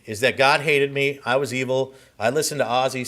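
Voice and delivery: normal talking voice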